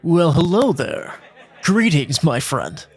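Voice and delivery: suave mage voice. Acted. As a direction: greet them like a smug charmer. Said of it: "and he goes".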